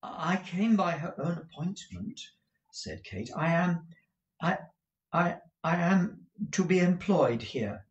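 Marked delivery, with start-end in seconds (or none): 0.0-2.2 timid. A narrator's reading. Nervous Kate answers mocking footman. 3.3-7.8 put-on high-pitched, halting young newcomer; nervous stammer. A narrator's reading. Kate stammers before the footman.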